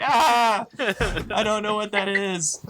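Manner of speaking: slow, high, comically bewildered cry; baffled cat. Acted. Game master voicing a cat confused by its owner, high mock wail.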